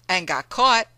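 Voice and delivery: Mammy jabbing at John, pointed; sharp retort. Acted. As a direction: needle him.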